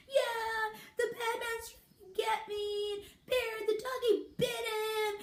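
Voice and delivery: put-on little kid voice. Little girl tells her mom what happened, childlike. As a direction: lightly voice a five-year-old. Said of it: "I was a little kid".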